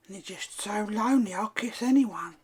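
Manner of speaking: high-pitched put-on old lady; lonely old lady. Old lady excuses kissing the lonely birds, pleading.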